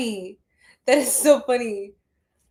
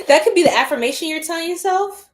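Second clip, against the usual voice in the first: laughing; 6 semitones higher, 6 dB louder than usual. Real, not acted. Laughs through line.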